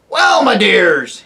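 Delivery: put-on high, loud querulous old dwarf; deaf old dwarf. Deaf dwarf to the owl, loud querulous old man. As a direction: bark it like an old man hard of hearing.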